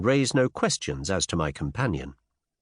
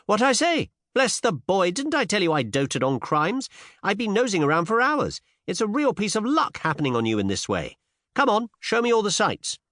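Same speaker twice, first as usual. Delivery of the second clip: put-on high, eager young woman; thrilled Cinderella; 11 semitones higher than usual. A narrator's reading. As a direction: gush with high-pitched excitement.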